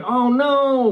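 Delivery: high, drawn-out mock lament; drawn-out "oh no" of put-on grief; mock dismay. Mock dismay at doctor's news.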